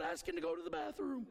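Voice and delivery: usual speaking voice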